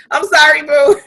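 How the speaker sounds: laughing through the apology; laughing sorry to a friend. Real, not acted. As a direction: giggle out sorry, boo.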